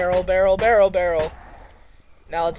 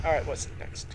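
ordinary delivery

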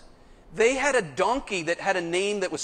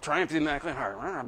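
mock zoned-out drone, lower and softer; religious coma; 4 semitones lower, 5 dB quieter, faster than usual. Imitating people who recite prayers without meaning: zoned-out drone. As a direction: recite it like a half-asleep churchgoer. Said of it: "this religious coma".

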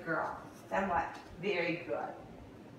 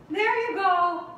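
high, loud happy praise; high excited praise; 12 semitones higher, 9 dB louder, much slower than usual. Real, not acted. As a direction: cheer her on when she gets it. Puppy finally obeys.

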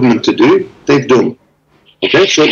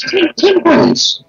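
much higher, slow, disbelieving echo; incredulous repeat; slower than usual. Retold or imitated. Repeating the window cleaner's price back, incredulous. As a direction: echo the price as if it's outrageous.

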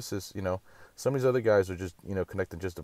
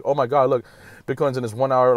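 loud, raised mock amazement; mock excitement; 5 semitones higher, 9 dB louder than usual. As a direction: imitate over-excited chart watchers. Mocking over-excited one-hour chart traders.

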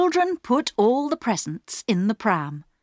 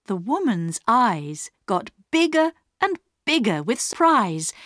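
usual speaking voice

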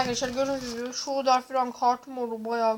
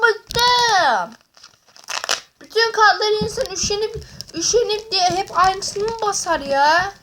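higher, louder exasperated complaining; fed-up outburst; 6 semitones higher, 4 dB louder, slower than usual. Real, not acted. Tired of duplicate cards, venting loudly to viewers. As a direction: vent loud frustration at the packs.

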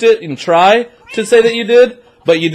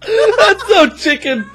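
slow, high, laughing through it; dawning joy; 12 semitones higher, slower than usual. Real, not acted. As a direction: giggle out the reveal. Laughing over the caught cat.

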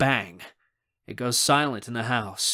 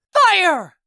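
high, loud battle order; shouted command; 11 dB louder than usual. A narrator's reading. Friend orders team to shoot paintballs, shouting. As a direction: yell it like a firing order.

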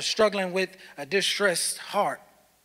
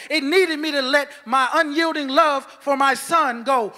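raised, driving sermon voice; full-force preaching; 5 semitones higher, 4 dB louder than usual. Acted. Pastor preaching to the church in full force. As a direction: preach it out to the whole congregation.

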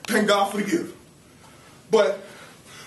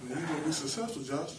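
low and much softer, coaxing; soft encouragement; 7 semitones lower, 14 dB quieter than usual. Retold or imitated. Preacher imitating cheering up Josh, low and hushed. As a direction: gently lift him up. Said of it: "come to you and say".

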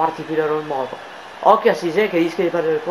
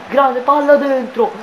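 breathless italian goal commentary; 7 semitones higher, 4 dB louder than usual. Real, not acted. Dangerous ball, drawn-out call.

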